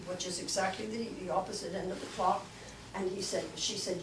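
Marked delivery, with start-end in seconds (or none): none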